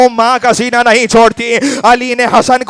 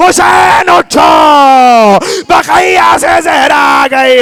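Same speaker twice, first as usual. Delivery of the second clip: crying call; 5 semitones higher, slower than usual. Acted. Zahra calling Husain at Ashura.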